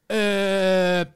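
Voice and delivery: loud, high, hesitant mock-French; hammed-up French accent, asking hopefully; bad French accent. Australian customer asking for cigarettes in France, bad French accent. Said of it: "I don't speak a lick of, of French".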